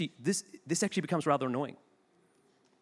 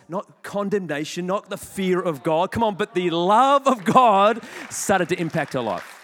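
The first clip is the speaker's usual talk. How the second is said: slow, rising to a louder preaching call; building preaching; 6 dB louder, slower than usual. Real, not acted. Preacher builds to the love of God, louder. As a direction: drive it home, slow and louder. Sermon climax on God's love.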